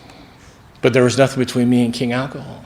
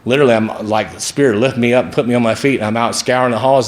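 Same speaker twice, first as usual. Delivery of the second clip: ordinary talk, nothing marked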